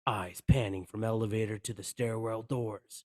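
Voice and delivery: ordinary talk, nothing marked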